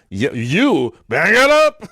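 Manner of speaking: drawn-out shout. Retold or imitated. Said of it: "You're like".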